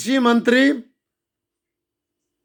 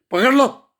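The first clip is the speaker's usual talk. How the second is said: angry call; 4 semitones higher than usual. Real, not acted. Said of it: "घर में बैठा आग बबूला हो रहा हूं".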